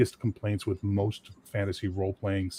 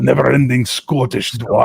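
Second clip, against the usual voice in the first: mock Scottish; 2 semitones higher, 9 dB louder than usual. Mocking the Scottish dwarf.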